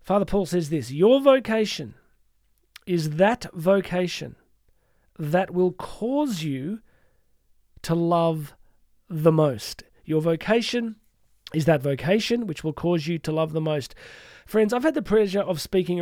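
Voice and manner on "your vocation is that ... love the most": very slow, phrase by phrase; measured reading with weighty pauses; slow, deliberate quote. Real, not acted. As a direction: let each phrase land, with long pauses. Quote read out deliberately, then repeated.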